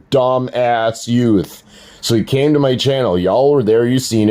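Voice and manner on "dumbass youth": "dumbass youth" said very slowly; deliberate emphatic name-calling; pointed label. Shown as a demonstration. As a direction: drag out "dumbass youth" like a verdict.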